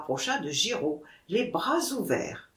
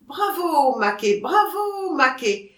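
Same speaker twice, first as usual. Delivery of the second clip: high crowd cheer; 7 semitones higher, 3 dB louder than usual. A narrator's reading. The company applauds Maquet's offer, cheering. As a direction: cheer him on, pitched well up. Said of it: "cria la société".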